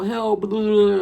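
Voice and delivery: lightly hammed-up call for help; mock fake drowning cry. Kid faking drowning, mock cry for help. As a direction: fake a kid's cry for help, half-hearted. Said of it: "kids fake drowning".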